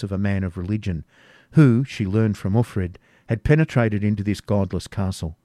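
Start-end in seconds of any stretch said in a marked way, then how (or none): none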